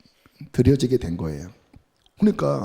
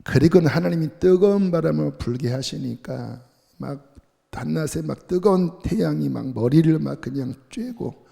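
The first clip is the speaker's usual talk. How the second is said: ordinary talk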